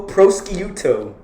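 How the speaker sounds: "prosciutto" sounded out as pross-kee-YOO-toe; mispronounced prosciutto. Shown as a demonstration. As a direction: slowly say "prosciutto" with a hard sk and a YOO. Mispronounces prosciutto, gets corrected.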